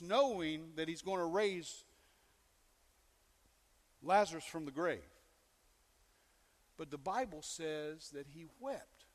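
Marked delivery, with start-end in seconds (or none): none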